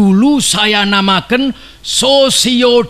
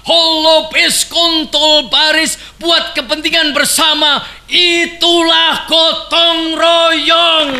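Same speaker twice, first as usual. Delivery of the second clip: higher, shouted, then slowed at the end; rousing shout; 7 semitones higher than usual. Real, not acted. Orator's rousing peak.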